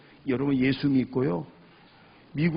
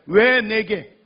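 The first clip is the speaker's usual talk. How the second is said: loud, high, challenging why; accusing demand; 7 semitones higher, 10 dB louder, much slower than usual. Retold or imitated. Man arguing with God in prayer, accusing demand. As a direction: confront God with a raised, wronged voice. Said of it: "이렇게 따지듯 기도했는데 이제는 그게 아니에요".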